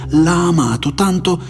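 fervent character speech, lifted in pitch; impassioned speech. A narrator's reading. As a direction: speak with conviction, pitched high and pressing.

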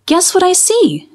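put-on a young brother teasing with a riddle; lightly voiced young boy. A narrator's reading. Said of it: "said Clark".

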